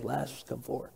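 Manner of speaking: a weak, hushed call with no command in it; timid quiet call. Shown as a demonstration. Preacher showing how Jesus did not call, hushed and timid. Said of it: "Jesus isn't saying".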